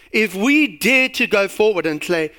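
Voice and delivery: usual speaking voice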